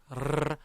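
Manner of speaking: "르" as a long tongue trill; rolled R. Shown as a demonstration. Teacher explaining the Spanish R, rolled R. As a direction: roll "르" on the tongue tip. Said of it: "굴려주셔야 돼요".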